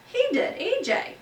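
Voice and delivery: put-on high, eager boy voice; boy pointing at A J tattles; tattling boy. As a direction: tell on him like a schoolboy. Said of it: "Garth Tugwell pointed at A J".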